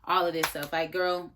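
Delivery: normal talking voice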